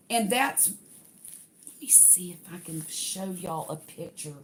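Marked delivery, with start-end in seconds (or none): 1.7-4.3 whispered aside to herself; soft whisper, thinking aloud. Real, not acted. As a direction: breathe it under your breath while searching. Muttering to herself while searching.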